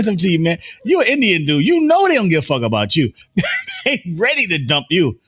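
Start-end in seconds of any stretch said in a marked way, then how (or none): none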